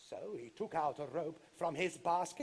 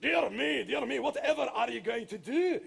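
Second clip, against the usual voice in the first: flustered; 5 semitones higher, 5 dB louder than usual. Acted. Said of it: "asked the giant, totally confused".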